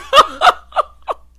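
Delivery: high, loud peal of laughter; loud cackle. Real, not acted. Host cracking up after dismissing Karen, loud cackle. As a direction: erupt in a big cackle.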